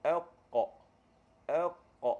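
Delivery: "elqa" dengan qaf dari pangkal lidah, lantun; qalqalah qaf. Shown as a demonstration. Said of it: "bila kita baca qaf kan". (qaf mati dengan lantunan)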